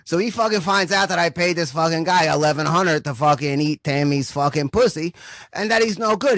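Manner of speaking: normal talking voice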